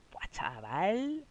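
slow, soft, stretched-out "chaval" in disbelief; slow stunned "buah, chaval"; awed wow. Real, not acted. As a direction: let the amazement drag out slowly. Amazed at a big casino win.

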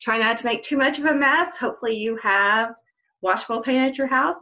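ordinary delivery, nothing marked

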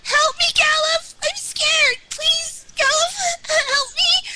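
loud, high, frightened cries for help; scared pleading. Acted. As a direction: beg for rescue in panic. Character vanishing, begging for help.

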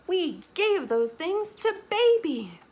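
high, loud, slow declaration; crying out. A narrator's reading. Market sellers explaining the gifts, crying out. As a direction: announce it proudly and loudly. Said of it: "cry Missus Ade".

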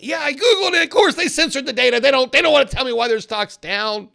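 high, loud mock shout at the spouse; hammed-up outburst of a losing trader; frustrated yell. Investor snapping back at spouse over stock loss, mock shouting. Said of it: "You say".

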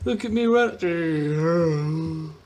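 very slow drawn-out boast; mock proud. Gamer bragging slowly about running. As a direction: stretch every word, showing off.

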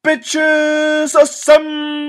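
very high, drawn-out battle call; slow, high mock superhero call; high slow rallying cry. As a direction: stretch it out like a hero's cry.

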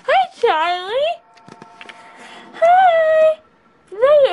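high, cooing greeting to a dog. Real, not acted. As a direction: coo at the puppy. Baby talk to dog.

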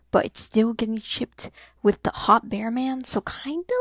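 hushed unvoiced whisper. Real, not acted. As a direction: whisper it like a secret. Gossipy aside about the ship.

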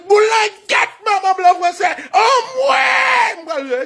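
very high acted yell ending in a long howl; acted battle frenzy; enraged rebel cry. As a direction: holler it like a charging rebel.

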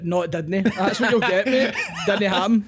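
scoffing, laughing through it; mock the doubters with a laugh; laughing mock put-down. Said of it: "People call that bullshit out".

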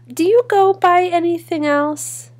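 teacher calling out. A narrator's reading. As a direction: call it out brightly across a classroom. Teacher at roll call addressing her.

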